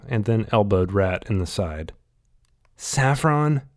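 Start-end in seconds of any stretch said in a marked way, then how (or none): 2.8-3.6 high, disbelieving question; shocked, can't believe it; incredulous. Acted. Shocked at wrong powder.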